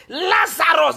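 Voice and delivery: high, shouted call of a name; Jesus. Acted. Preacher as Jesus calling the dead man, shouting. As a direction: shout to summon someone. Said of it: "he shouted with a loud voice".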